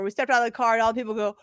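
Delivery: ordinary talk, nothing marked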